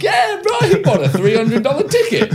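very high-pitched excited yell; hammed-up excited crowing; mock shout. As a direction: holler it out, pitch shooting up. Yelling about heckled audience member.

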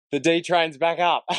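high-pitched, laughing through the words; real laughter lifting the voice. Real, not acted. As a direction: crack yourself up with the running joke. Running joke, speaker laughing.

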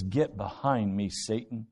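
restrained imitation of a stern rebuke; blunt rebuke. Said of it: "Jesus speaks to him very bluntly".

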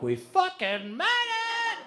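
high, drawn-out cry of triumph; elated cry. Retold or imitated. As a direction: stretch it out in acted, overjoyed relief. Celebrating reaching shanty after being lost.

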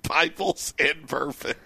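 slow, soft repeat through laughter; laughing. Real, not acted. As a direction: savour the line, cracking up. Repeats a texter's line while laughing.

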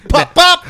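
loud high pop pop; mock gunshots. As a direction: shout pop pop like gunfire. Mimicking gunshots.

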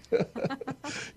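high laugh at the punchline. Real, not acted. Grandfather laughing at his story's punchline. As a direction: laugh at your own joke.

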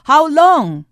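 loud, high, pleading; full-force preaching shout. Real, not acted. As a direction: cry it out to the congregation. Preacher's peak appeal.

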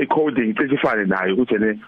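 ordinary talk, nothing marked

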